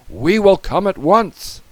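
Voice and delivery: high, loud firm promise; urgent response. A narrator's reading. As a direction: answer the alarm at full readiness. Firemen answer emergency.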